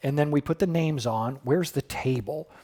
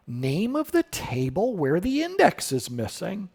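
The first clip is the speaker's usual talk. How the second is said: ordinary delivery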